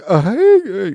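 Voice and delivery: loud, high, cracked croak; voice cracking. Pretend. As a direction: fake a wrecked voice that breaks high. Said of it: "your voice is all cracking".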